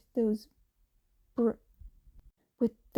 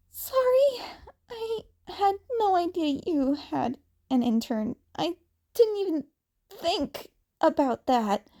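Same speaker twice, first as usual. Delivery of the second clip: put-on higher, young upset boy; Izuku voice; 7 semitones higher than usual. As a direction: apologize in a higher, teary young voice.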